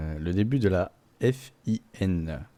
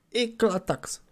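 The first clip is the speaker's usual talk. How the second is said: high exclaimed; much slower than usual. Acted. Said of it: "repeated word, two takes".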